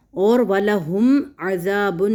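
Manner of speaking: slowed recitation, lengthening the alif in عَذَابٌ; careful tajweed reading; Quranic recitation. Shown as a demonstration. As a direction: recite وَلَهُمْ عَذَابٌ with a long madd. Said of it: "ذال الف و ذا الف مدہ ہے".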